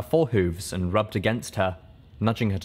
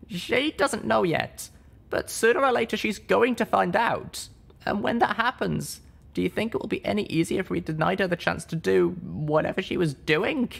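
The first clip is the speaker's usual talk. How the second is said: much higher, earnest reasoning; pleading argument; 11 semitones higher than usual. Acted. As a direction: persuade him gently. Littlepip argues.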